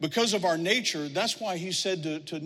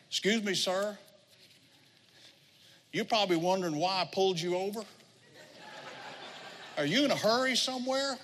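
higher-pitched Southern officer; North Carolina traffic cop; 4 semitones higher than usual. Retold or imitated. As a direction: imitate a polite Carolina cop at the car window, rising at the end. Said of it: "He had to have been Tyler Sane's older brother".

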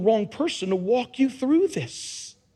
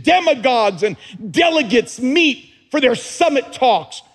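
higher, louder impassioned sermon; preaching at full force; 5 semitones higher, 4 dB louder than usual. Acted. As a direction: preach it to the rafters.

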